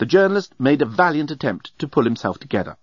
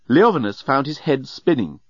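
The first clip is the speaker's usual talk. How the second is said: ordinary delivery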